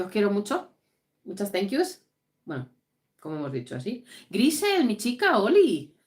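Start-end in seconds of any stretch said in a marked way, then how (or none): 4.3-5.9 muy aguda y algo más fuerte, con alegría; llámalas con ilusión al verlas; saludo emocionado. Real, not acted. Saluda emocionada a sus seguidoras. (saludo alegre a las seguidoras del directo, muy agudo)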